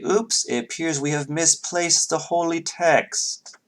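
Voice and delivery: high, slow mock apology; mock-sheepish announcement. Duchess imagines confessing to worshippers, mock-sheepish announcement.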